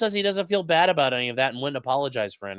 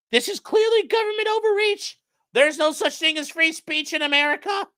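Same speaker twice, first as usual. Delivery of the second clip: much higher, whiny mock Kimmel voice; hammed-up high whine of outrage; mocking impression; 13 semitones higher, 3 dB louder than usual. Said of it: "he's absolutely fucking livid".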